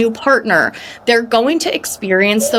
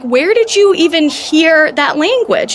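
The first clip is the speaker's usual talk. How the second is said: indignant mom; 9 semitones higher than usual. Retold or imitated. Indignant retort, high loud.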